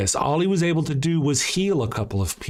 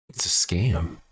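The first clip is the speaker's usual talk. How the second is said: low ominous verdict; 10 semitones lower, slower than usual. Pretend. To the audience about Jesus's healings, low ominous verdict. Punchline repeated in two voices.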